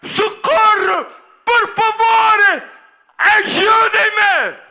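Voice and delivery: high, loud, drawn-out cry for help; desperate shouting. Acted. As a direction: scream for help, stretching every word. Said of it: "ele vinha a gritar". (gritos de socorro aflitos)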